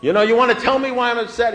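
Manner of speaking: high, shouted impatience; exasperated demand. Retold or imitated. Man begs his sponsor for the answer, exasperated demand. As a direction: yell it as if the answer were obvious.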